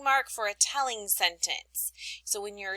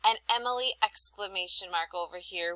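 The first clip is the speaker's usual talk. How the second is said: ordinary talk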